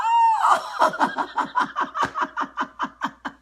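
long, high staccato cackle; high laughing fit. Real, not acted. Streamer cracking up at her vanished commenters, high laughing fit. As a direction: burst into a drawn-out, high-pitched laugh.

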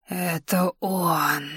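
put-on reverent old woman's whisper, very slow; very old faint voice. A narrator's reading. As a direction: breathe it out in awe, like an ancient crone. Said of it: "благоговейно прошептала старуха". (благоговейный шёпот старухи)

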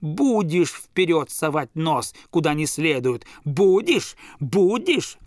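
slow scold rising to a loud, high shout; angry father. Acted. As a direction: threat building to a furious yell. Father scolding, shaking ear.

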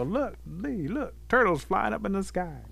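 high, excited shout to others; amazed calling out. Acted. Animals call each other to see the turtle, high and excited. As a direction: point it out in high-pitched amazement.

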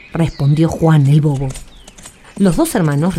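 ordinary delivery, nothing marked

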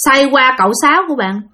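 usual speaking voice

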